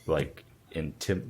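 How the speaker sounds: normal talking voice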